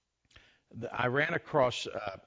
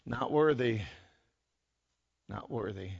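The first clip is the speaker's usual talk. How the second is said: soft acted reply, fading right down; humble refusal; 6 dB quieter than usual. Preacher as Isaiah declining the angels, humble fading refusal.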